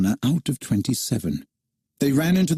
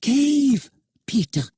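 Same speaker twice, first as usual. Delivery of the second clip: calling out a warning; 13 semitones higher, slower than usual. A narrator's reading. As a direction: call out to warn him. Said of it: "called out".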